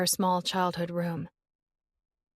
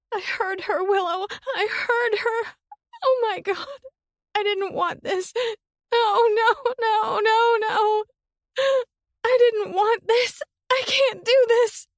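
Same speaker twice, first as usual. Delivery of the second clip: far higher, loud, sobbing; hysterical crying; 7 dB louder than usual. Acted. Summer sobbing hysterically to Willow on the phone. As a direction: come apart in hysterics. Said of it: "Hysteria bubbled from her sister's mouth".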